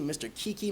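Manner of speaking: Kiki, a doubled kee in place of [name]; kids' mispronounced name. Shown as a demonstration. Kids botching teacher's name, Kiki with kee said twice. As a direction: say Kiki as two matching kee syllables. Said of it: "so many variations of my name".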